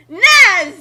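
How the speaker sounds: shouted battle cry. Acted.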